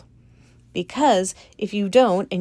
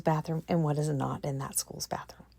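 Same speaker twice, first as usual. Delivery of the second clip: whisper; 5 semitones lower, 8 dB quieter than usual. Real, not acted. Trailing whispered repetition.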